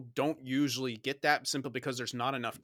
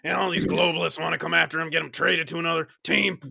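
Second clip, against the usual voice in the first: loud, higher-pitched mock tirade; mock angry rant; 5 semitones higher, 12 dB louder than usual. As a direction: shout like a fed-up ranter, hammed-up. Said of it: "getting mad like that".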